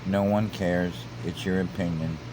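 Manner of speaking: ordinary talk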